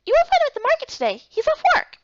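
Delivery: high, fast chirpy voice; small companion, quick. Acted. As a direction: rattle it off like a tiny helper.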